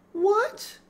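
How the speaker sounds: high, loud shocked gasp of a word. A narrator's reading. Laura hears Mary is dead, high and shocked. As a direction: jolt in disbelief.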